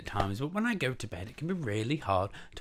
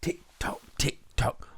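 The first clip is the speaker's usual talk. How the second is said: voiceless, evenly spaced ticks and tocks; whispered clockwork; ticking clock; 3 semitones higher than usual. Acted. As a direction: tick like a clock, steady and unvoiced. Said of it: "went the living room clock".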